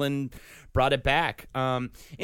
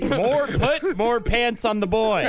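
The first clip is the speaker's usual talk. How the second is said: mock note-giving whine; 7 semitones higher, 4 dB louder, slower than usual. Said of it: "That's my one note".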